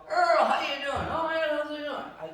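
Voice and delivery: high, loud, laughing hello; eager greeting. Retold or imitated. Host greets Dad at the door, high and eager. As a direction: greet him overly cheerfully.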